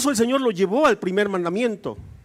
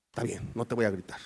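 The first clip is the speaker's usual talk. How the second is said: much lower and softer husband; meek husband; 12 semitones lower, 11 dB quieter than usual. Retold or imitated. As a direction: give in quietly to her. Esposo acepta la petición. (esposo sumiso, grave y bajito)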